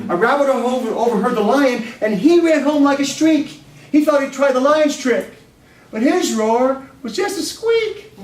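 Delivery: animated poem telling to students; lively poem recital. Acted. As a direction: recite with comic gusto.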